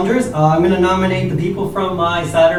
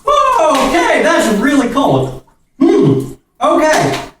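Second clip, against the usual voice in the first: gasping from ice water; high, shaken by the cold; cold shock; 9 semitones higher, slower than usual. Real, not acted. Just got soaked in ice.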